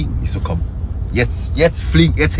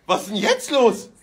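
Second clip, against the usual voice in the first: high laughing voice; 13 semitones higher than usual. Real, not acted. Laughing about tight trousers.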